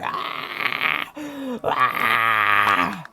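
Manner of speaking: long mock scream at no great volume; imitated scream. Retelling the screams as they got closer, half-voiced mock scream. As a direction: hold a breathy, drawn-out "aah". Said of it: "the screaming's still like".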